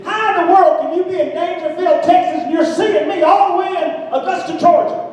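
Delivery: very high-pitched, amazed complaint; retold disbelief, voice shooting far up; incredulous protest. Preacher protests to friend far away in Texas, incredulous.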